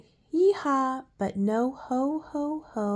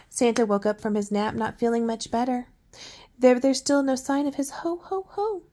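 ordinary delivery

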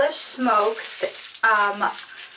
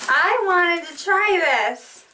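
higher, slow, eager call; real eager raised voice; excited yell; 6 semitones higher, slower than usual. Real, not acted. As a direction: sing it out with excitement. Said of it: "Am I yelling again?".

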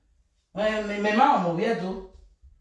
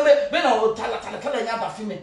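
ordinary talk

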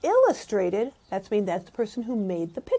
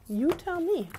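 plain reading voice

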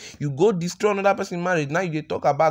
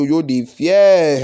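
slow, much louder "yo, the fear"; dramatic emphasis; 7 dB louder, much slower than usual. Acted. Gossip emphasis.

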